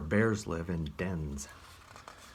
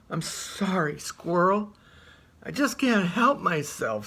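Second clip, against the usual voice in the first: apologetic bear; 9 semitones higher, 5 dB louder than usual. Acted. Bear about to eat squirrel.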